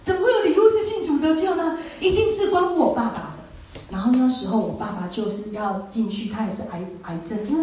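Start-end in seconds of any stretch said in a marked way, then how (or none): 0.0-3.8 high, thrilled rush of wonder; excitedly amazed at the dream's gift. Retold or imitated. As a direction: retell the thrill, voice climbing high. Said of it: "我就好兴奋哦".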